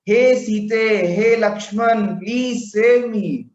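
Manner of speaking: high, slow, desperate shout; a demon faking a desperate plea, high and loud; loud cry for help. Acted. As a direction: call out as if dying for help. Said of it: "the Mayavi Marich shouts loudly".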